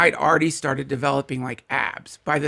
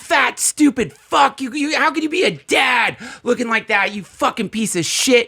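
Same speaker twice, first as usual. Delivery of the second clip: shaming shaman tirade; 9 semitones higher, 6 dB louder than usual. Acted. Shaman screaming insults at a retreat guest, furious. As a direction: scream abuse down at the pupil. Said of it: "Like, what a loser you are".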